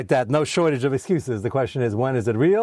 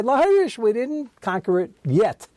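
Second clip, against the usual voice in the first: defensive excuse; 9 semitones higher than usual. Retold or imitated. Acting out their defensive excuse.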